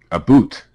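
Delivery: playful Canadian "a boot" for "about"; mock Canadian pronunciation. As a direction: echo "a boot" with a long OO. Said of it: "he's Canadian".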